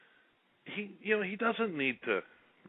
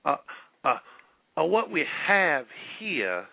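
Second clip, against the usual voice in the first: put-on Southern accent; 5 semitones higher than usual. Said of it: "in his best Southern accent".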